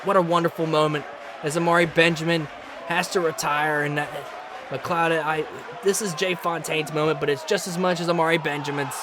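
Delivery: low, soft, heartfelt sign-off; thankful. Real, not acted. Commentator thanking viewers as a champion retires, subdued and thankful. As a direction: settle down into sincere gratitude. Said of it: "I'm so thankful that you guys tuned in".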